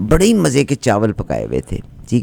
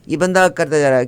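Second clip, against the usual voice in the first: ordinary talk, nothing marked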